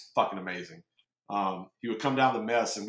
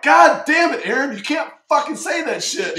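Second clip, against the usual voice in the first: much higher, much louder mock tirade; hammed-up furious yell; 12 dB louder than usual. Now the CMC himself yelling at a sailor, a hammed-up furious yell. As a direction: blow up at the sailor, full theatrical rage.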